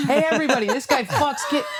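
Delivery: high mock announcement to a crowd; calling out. Comic denouncing the man to everybody, high call. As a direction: call out publicly, half laughing.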